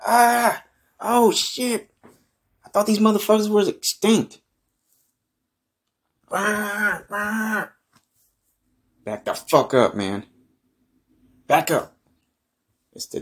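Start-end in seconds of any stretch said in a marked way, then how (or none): 0.1-4.4 high, loud panicked cry. Real, not acted. Pterodactyl attacks him. 6.3-12.0 frantic yelling. Real, not acted. Fending off mother pterodactyl.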